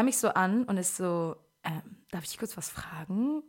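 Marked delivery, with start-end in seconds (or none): 1.6-3.4 quiet, slow and hesitant; imitating someone carefully asking permission; tentative question. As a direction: ask it softly, feeling your way. Guest cautiously asks a question.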